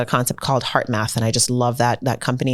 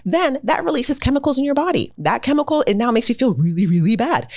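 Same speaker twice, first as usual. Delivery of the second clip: dramatic explanation; 10 semitones higher than usual. Real, not acted.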